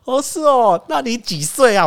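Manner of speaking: mock salesman asking age. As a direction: mimic the scripted question. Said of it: "然后他就说".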